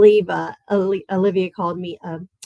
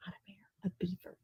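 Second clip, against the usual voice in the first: whispered aside; 19 dB quieter, much faster than usual. Real, not acted. Joke correction whispered aside.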